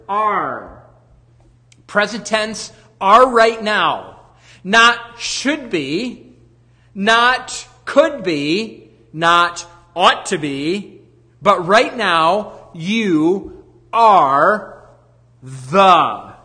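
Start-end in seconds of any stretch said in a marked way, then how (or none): 3.0-14.9 each phrase drawn out slowly with weight; slow emphatic contrast. Acted. Preacher to congregation, slow stressed contrast of tenses.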